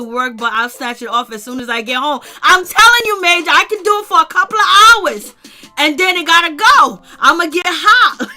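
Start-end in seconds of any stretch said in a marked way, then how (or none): 2.4-8.3 louder, higher, fast and fired up; excited and loud. Real, not acted. Vlogger ranting about taking her wig off, excited and loud. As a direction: hype it up, almost yelling.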